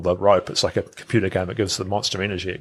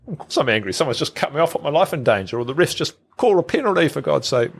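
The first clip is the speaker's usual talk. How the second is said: rising higher, quicker toward the ref's penalty; exasperated rant; 6 semitones higher than usual. Acted. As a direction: vent like a driver who's just been cut off. Acted exasperated self-talk while angry.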